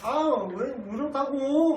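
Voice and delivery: slow, high, mocking retort; rude back-talk. Maids talking back to grandma, mocked slow and high. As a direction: drag it out rudely, mimicking the maids. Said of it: "말투가".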